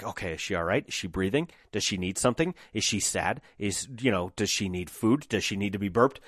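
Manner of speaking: hushed, worried rapid questions over the crib; anxious new parent. Retold or imitated. New father hovering over sleeping baby, hushed and fretful.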